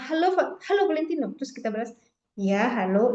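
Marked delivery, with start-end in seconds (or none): 0.0-1.3 high, bright "halo Valentino"; lively caller greeting. Shown as a demonstration. Teacher demonstrating the caller's lively greeting. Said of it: "Tapi kalau misalnya". 2.3-3.1 flat mismatched reply. Shown as a demonstration. Teacher demonstrating a reply that doesn't match the caller. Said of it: "itu nggak nyambung".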